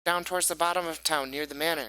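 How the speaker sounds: put-on high, loud, excited kid voice; high-pitched child passing on a secret; eager young boy Pip. Game master plays Pip, a boy.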